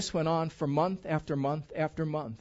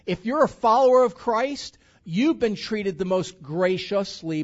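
preaching loud; 4 semitones higher, 7 dB louder than usual. Real, not acted. Preacher hammering home point.

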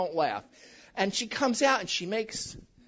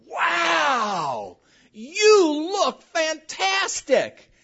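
very slow, drawn-out gushing compliment; wow-factor praise; 4 semitones higher, 3 dB louder, much slower than usual. Shown as a demonstration. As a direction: stretch every word in theatrical amazement. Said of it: "looking for that wow factor directed toward her".

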